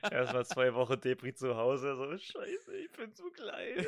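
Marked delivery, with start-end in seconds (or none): 2.2-3.8 higher, slower mock self-pity; whiny sulking voice. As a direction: whine it like a sulking loser. Mocks a sulking, depressed man.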